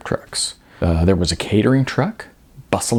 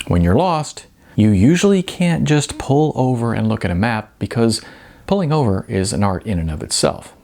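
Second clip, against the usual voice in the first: plain reading voice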